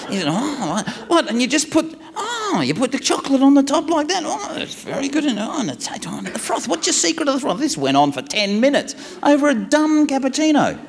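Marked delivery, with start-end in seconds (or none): 0.3-7.6 high-pitched imitated wonder; hammed-up breathless fascination; excited. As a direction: ooh and aah over trivial steps. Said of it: "he's so excited".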